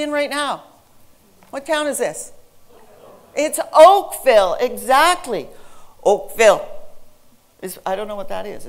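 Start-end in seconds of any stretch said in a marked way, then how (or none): 3.3-4.5 OAKVILLE with first syllable high and loud, second reduced; loud, lifted OAK, then a short falling ville in OAKVILLE; stress demonstration. Shown as a demonstration. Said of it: "that's the way stress works". 6.0-6.6 OAKVILLE in two short, evenly weighted beats; short, loud, level-stressed OAKVILLE; non-English stress demo. Shown as a demonstration. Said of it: "It's Punjabi or, or it's Korean".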